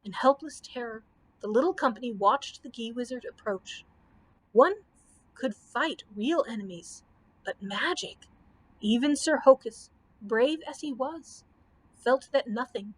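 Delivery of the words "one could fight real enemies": ordinary delivery, nothing marked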